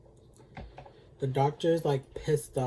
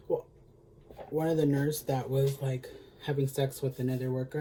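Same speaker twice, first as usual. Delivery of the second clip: ordinary delivery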